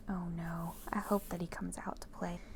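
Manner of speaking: usual speaking voice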